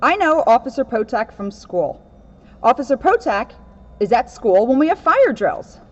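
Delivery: lively read-aloud storybook voice; picture book reading, lightly animated; reading to children. Acted. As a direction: read it to a room of kids.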